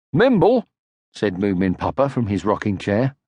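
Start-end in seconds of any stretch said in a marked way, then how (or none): 0.1-0.7 higher, louder call. A narrator's reading. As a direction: call her name from the rocking chair. Papa calling out.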